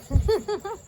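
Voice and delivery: soft high giggle; teasing giggle. Real, not acted. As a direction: giggle after your own tease. Teases about setting up stall. (चिढ़ाती हुई हल्की हँसी)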